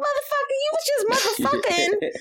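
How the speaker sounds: shrill-high disbelieving comeback; indignant retort. Retold or imitated. She snaps back high at the clerk who banned cussing.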